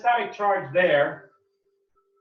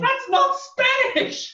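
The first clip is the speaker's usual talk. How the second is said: surprised; 4 dB louder, much slower than usual. Real, not acted. Teacher reacting to joke.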